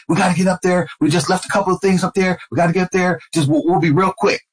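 high-pitched, urgent begging, retold; insistent pleading. Retold ex-wife pleading with police.

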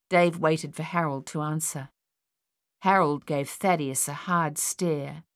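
ordinary delivery

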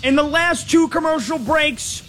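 booming over-the-top football analyst impression; Ron Jaworski impression. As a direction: shout the numbers like a hyped TV analyst impersonation. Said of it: "my Frank Caliendo impression of Ron Jaworski".

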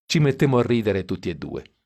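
ordinary delivery

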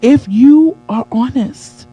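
deliberate slow emphatic repeat; slowed for emphasis. Shown as a demonstration. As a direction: lean on it slowly, let it land. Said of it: "let's, let's pause right here".

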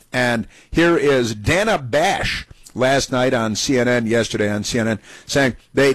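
ordinary delivery

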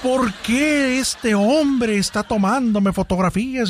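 slow, high, self-pitying whine; mock victim voice. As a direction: ham up the poor frightened lady. Woman plays victim before judge.

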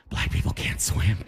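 mock confidential whisper; hushed, unvoiced aside. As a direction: whisper it like a touchy secret. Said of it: "why are you whispering?".

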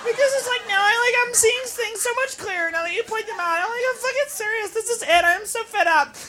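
high, soft earnest babble; acted maudlin party girl; drunk girl's resolution. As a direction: confide an epiphany to your girlfriends. Drunk girl's tearful resolution to friends.

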